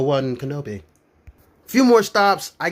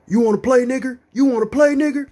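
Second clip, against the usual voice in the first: aggressive taunt; 9 semitones higher than usual. Retold or imitated. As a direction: taunt the whole bus, spoiling for a fight. Man on bus provoking a fight.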